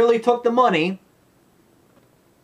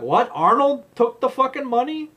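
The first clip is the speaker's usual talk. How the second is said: higher, incredulous put-on voice; mock shock; 8 semitones higher than usual. Recalling watching the movie, mock shocked disbelief. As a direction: react in disbelief at the twist. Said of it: "I'm like".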